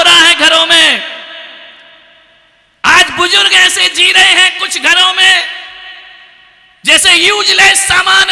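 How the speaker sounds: high, loud, ringing declamation with long pauses; full-force preaching plea. Real, not acted. Preacher pleads against parents' humiliation at home, crying out loudly. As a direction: cry out to the whole hall.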